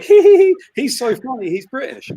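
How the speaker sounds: high tittering mock laughter, then sinking; squeaky hee-hee, trailing down; mock giggling. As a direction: imitate giggly fawning admirers. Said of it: "they just laughed. They were like".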